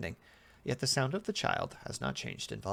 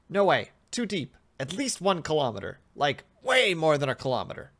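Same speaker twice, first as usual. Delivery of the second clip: louder, higher incredulous answer; emphatic test subject; 5 semitones higher, 8 dB louder than usual. Acted. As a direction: insist on it with raised voice. Subject insists the hole is deep.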